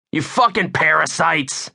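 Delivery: high-pitched, furious holler; angry holler. A narrator's reading. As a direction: holler it at them in anger. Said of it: "I hollered".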